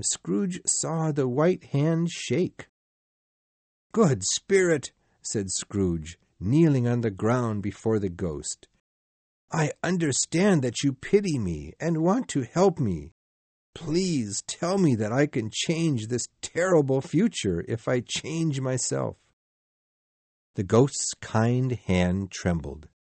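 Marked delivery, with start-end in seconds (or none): 3.9-4.9 high, earnest plea; pleading. Acted. Scrooge kneels and pleads. 9.5-19.2 higher, earnest plea; earnest pleading. Acted. Scrooge kneels and pleads.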